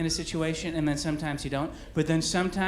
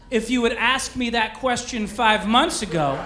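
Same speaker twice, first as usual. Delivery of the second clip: raised voice, building to a louder punchline; retold yell back; 5 semitones higher, 4 dB louder than usual. Husband answers wife across the baby store, in a retold yell. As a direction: call it back across the aisles. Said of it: "So I yell back".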